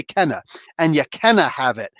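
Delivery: "Kenna" said short with a falling tune, Scottish style; "Kenna" as the Scottish can't. Shown as a demonstration. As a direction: say "Kenna" as a Scot would, stressed first syllable. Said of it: "she has to say".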